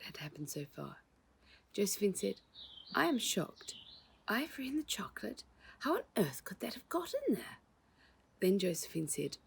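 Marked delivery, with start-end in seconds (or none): 2.9-7.6 higher, hushed astonished lady; mild shock. A narrator's reading. Josephine hears about the ivory, hushed shock. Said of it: "almost as if she was speaking to herself".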